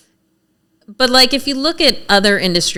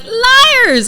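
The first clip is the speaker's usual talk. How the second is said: imitating a lawmaker's yell, much higher and louder; retold yell; 5 dB louder than usual. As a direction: shout the word Liars! sharply, high and loud, as a mock yell. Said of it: "yell at me".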